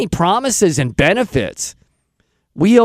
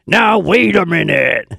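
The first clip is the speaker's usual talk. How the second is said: cartoon cowboy attempt. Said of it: "adopted some kind of cartoon character".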